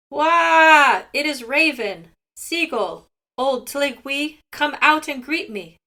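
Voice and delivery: calling out loudly and high to Seagull. A narrator's reading. Raven calling Seagull out of his house, loud and high. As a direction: Raven bellows at the door to be let in. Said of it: "Raven called out in a loud voice".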